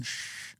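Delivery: unvoiced hush; whispered shush. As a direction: shush them quietly. Shushing in acted scene.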